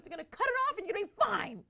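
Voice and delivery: very high, loud mom impression; practical mom's voice. Mom answering screaming daughter, loud high-pitched insistence. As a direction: shout the reassurance insistently. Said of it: "my mom's very practical too".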